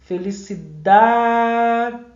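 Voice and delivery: "Felicidade" with "da" held long on a level note; stressed-syllable scansion. Shown as a demonstration. Teacher showing the tonic in "Felicidade", drawn-out scansion. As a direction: hold the "da" of "Felicidade" like a chant. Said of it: "tônica".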